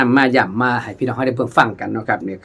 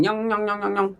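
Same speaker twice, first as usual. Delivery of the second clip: imitating khaen sound; 8 semitones higher, 6 dB quieter than usual. Shown as a demonstration. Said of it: "เสียงนี่". (เลียนเสียงแคนด้วยปาก เสียงสูงและช้า)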